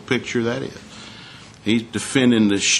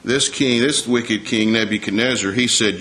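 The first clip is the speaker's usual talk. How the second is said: ordinary talk, nothing marked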